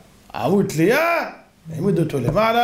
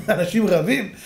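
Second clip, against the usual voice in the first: usual speaking voice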